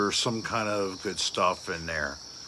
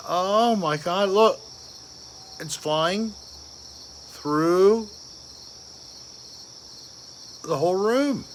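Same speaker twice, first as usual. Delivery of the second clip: higher pitch, urgent pointing out; alarmed excitement; 7 semitones higher than usual. Real, not acted. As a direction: point at it flying across the room. Watching something fly round room.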